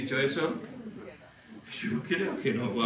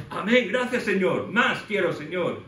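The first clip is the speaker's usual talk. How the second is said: higher, louder, slowing ecstatic thanks; fervent Paul; 5 semitones higher, 6 dB louder, slower than usual. Acted. Paul answering Silas in prayer, fervent and excited. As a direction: pour out eager praise. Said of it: "Y Pablo".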